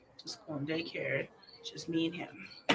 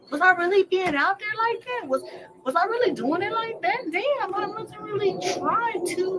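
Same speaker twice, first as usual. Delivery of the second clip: much higher and louder, exclaiming at herself in disbelief; mock disbelief; 14 semitones higher, 10 dB louder than usual. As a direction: cry out in shocked self-scolding disbelief. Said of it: "you're like".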